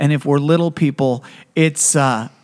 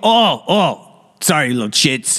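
lightly voiced fake apology; a hint of sheepish uncle; mock sorry uncle. Uncle mock apologizing to kids. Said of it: "And he'd go".

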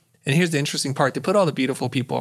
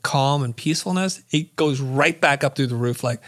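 ordinary delivery

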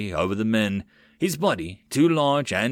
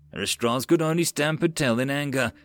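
plain reading voice

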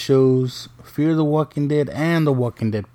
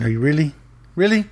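second question jumps much higher; exasperated disbelief; 4 semitones higher, much faster than usual. Retold or imitated. Dad appalled that both kids think it's a car, incredulous. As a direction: let disbelief push the pitch up.

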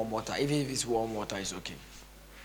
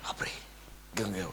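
ordinary talk

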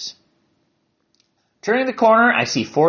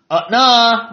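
put-on high, louder child's objection; little girl exclaiming; 8 semitones higher, 5 dB louder than usual. Young girl contradicts the boys, high exclaiming voice. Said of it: "exclaims the girl".

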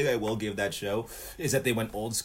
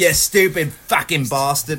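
mock Cockney; 4 semitones higher, 8 dB louder, slower than usual. Mock Cockney banter reply.

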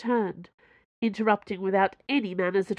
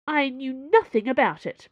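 higher, louder sudden denial; burst out; 6 semitones higher, 5 dB louder than usual. A narrator's reading. Madame Sue denies everything to the cardinal, bursting out. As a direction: blurt it out defensively. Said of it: "Madame Sue burst out".

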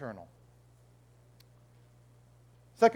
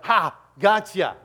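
loud, drawn-out gloating taunt; mock triumphant gotcha; 2 semitones higher, 7 dB louder, slower than usual. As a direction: crow it like you caught someone out.

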